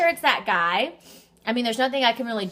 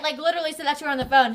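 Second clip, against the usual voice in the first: usual speaking voice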